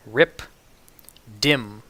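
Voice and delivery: ordinary talk, nothing marked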